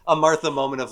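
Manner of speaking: ordinary delivery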